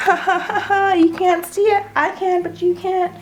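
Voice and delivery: high, sing-song mock gloating; playful taunting. Vlogger hiding an unreleased painting from viewers, playful taunt. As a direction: tease them like a kid with a secret.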